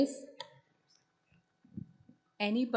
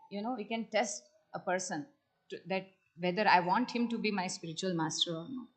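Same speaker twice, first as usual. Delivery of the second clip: normal talking voice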